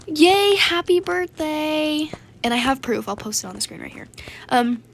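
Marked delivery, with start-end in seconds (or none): 0.1-2.1 high, loud yay slowing into drawn-out wishes; mock celebration. Playing along with her birthday announcement, exaggerated fake cheer. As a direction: put on a hammed-up cheer.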